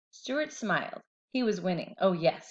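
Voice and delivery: ordinary delivery, nothing marked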